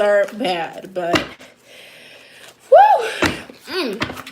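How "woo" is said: high whooping cheer; excited woo. Real, not acted. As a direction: let out a loud happy woo. Excited cheer during reading.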